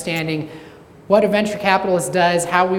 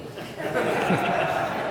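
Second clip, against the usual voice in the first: breathy laugh; 2 semitones higher, 7 dB quieter than usual. Real, not acted. Laughs at own joke.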